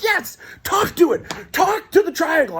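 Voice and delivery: high, elated retold cheering; excited urging. Passenger urging driver to flash at the triangle, excited. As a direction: egg him on in a high cry.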